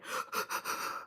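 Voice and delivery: sudden unvoiced gasp of alarm; a retold startled gasp; sharp gasp. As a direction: catch your breath at a sudden urge to cry. Gasp while driving, retold.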